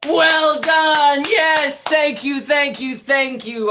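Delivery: high, loud show-host cheer; excited host. Real, not acted. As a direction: hype up the applause. Host bursts in after scene.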